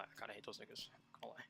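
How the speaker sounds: hushed confession under the breath; secretive whispered aside. Real, not acted. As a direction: drop to a whisper for the admission.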